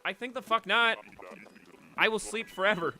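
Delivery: high, loud sassy pet voice; loud, high-pitched defiant pet voice; pet's mock defiance. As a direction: voice the hamster refusing with attitude. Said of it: "she's like".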